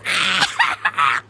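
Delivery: laughing at her own joke; amused cackle. Real, not acted. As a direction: burst into a cackle at your own pun.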